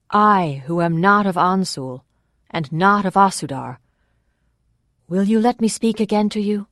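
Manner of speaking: loud, measured public address; ringing, formal appeal; orator addressing a crowd. Acted. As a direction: project to a packed square, asking for a hearing.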